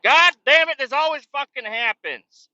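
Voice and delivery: high, loud exasperated curse; hammed-up fed-up yell; frustrated outburst. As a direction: blow up at the maze of buildings. Retold frustration at wrong buildings.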